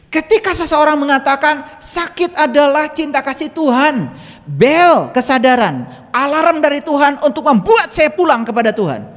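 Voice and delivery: high, loud, ringing proclamation; preaching at full force. Acted. Preacher telling a friend's testimony to the congregation, full-force preaching.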